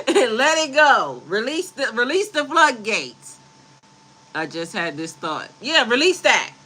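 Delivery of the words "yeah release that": ordinary talk, nothing marked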